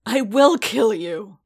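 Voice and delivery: snarled threat. A narrator's reading. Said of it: "Paz snarled".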